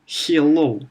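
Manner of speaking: hello said "hyello" with a Y glide; mock Russian "hyello"; Russian-accented hello. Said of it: "They say, for example".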